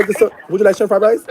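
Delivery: high, hammed-up counter voice, pitched well up; a joking vendor offering fried rice and chicken; mock takeout vendor. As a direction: play a pushy takeout clerk selling chicken. Jokingly pushes chicken on caller.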